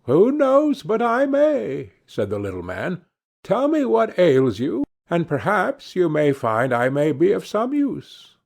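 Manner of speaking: a sly dwarf dangling hope. Acted. Dwarf offers help.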